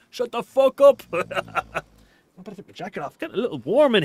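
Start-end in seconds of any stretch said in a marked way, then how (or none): 0.1-1.0 a higher, louder mock yell from annoyed players; retold shout. Retelling random players yelling at a kid, mock shout. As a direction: bark it like a fed-up stranger on voice chat. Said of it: "random players were shouting".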